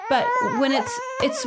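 laughing through the words; words bubbling with laughter. Real, not acted. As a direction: break into a real laugh mid-sentence. Laughing while describing kids' anger.

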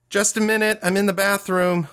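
high-pitched call through the door; shouted. A narrator's reading. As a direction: call out high, as if to another room. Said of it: "turned toward the door and shouted".

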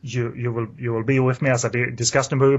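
ordinary talk, nothing marked

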